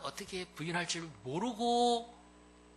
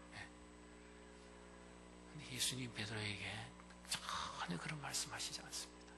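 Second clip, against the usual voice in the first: usual speaking voice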